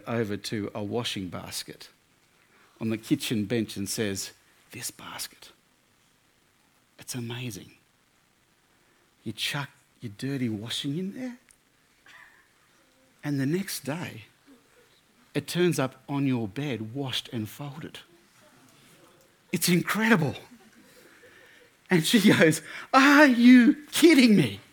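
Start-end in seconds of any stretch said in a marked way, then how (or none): 4.7-20.3 soft mock-reverent hush with long pauses; hushed marvelling. Boyfriend reveals the magic basket. 22.9-24.6 high, loud, slow mock outrage; exasperated disbelief. Exasperated girlfriend answers boyfriend.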